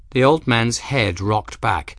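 usual speaking voice

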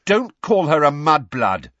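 loud, high, indignant rebuke; very angry. Acted. Two friends defending Hermione from an insult, very angrily. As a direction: shout it down in outrage. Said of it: "very angrily".

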